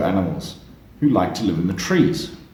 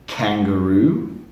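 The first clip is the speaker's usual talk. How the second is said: slow model word; slower than usual. Shown as a demonstration. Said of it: "Can you say".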